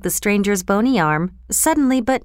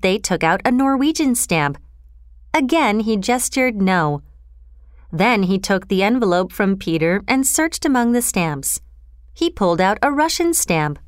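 ordinary delivery

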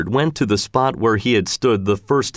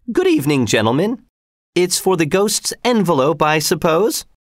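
lightly voiced woman, pitched higher; a hint of a higher-voiced visitor greeting her employers; Missus Giry; 6 semitones higher than usual. A narrator's reading. Woman character greets the managers.

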